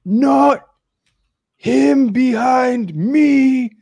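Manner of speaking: high, very slow, shouted; the ape stressing the details. Retold or imitated.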